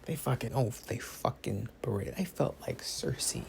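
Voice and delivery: slow, whispery aftermath; wounded dramatic hush. Pretend. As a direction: whisper it as if wrung out by the ordeal. Plays wounded after the outburst.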